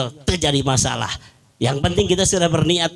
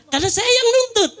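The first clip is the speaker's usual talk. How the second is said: laughing; faster than usual. Retold or imitated. Wife's confession retold.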